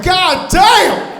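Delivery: high, loud amazed yell; mock amazed shout. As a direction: yell it like the robot just blew your mind. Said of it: "You're like".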